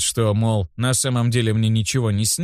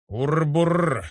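higher-pitched rolled-r growling noise; stomach rumble; 5 semitones higher than usual. A narrator's reading. Hungry boy's stomach interrupts him, comic rumble. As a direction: imitate a rumbling belly. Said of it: "забурчал мой живот".

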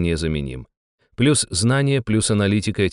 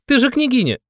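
much higher, desperate panicky appeal; panicked shriek; 3 dB louder than usual. Acted. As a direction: plead shrilly in panic.

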